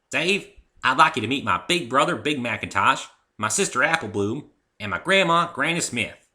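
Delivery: hearty farm mare welcoming a friend; Applejack's voice. Acted. Applejack introduces family.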